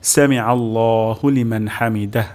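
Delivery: slow, syllables held; slow recitation. Shown as a demonstration. Teacher reciting slowly before giving the meaning. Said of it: "এখন আমরা অর্থটি জেনে নিব".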